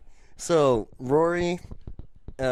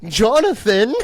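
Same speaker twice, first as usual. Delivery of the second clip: high, loud questioning call; confused bellhop; 8 semitones higher, 8 dB louder than usual. Retold or imitated. Bellhop calls for the guest in a sleeping couple's room, high questioning call. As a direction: call out the name as a lost bellhop, half laughing. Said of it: "and this bellhop's".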